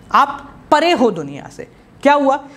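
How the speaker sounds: ordinary delivery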